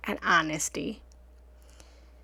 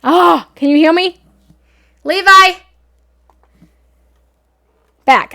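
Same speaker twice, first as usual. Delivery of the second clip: calling out; 6 semitones higher, 15 dB louder than usual. Real, not acted. Calling out, mic dead.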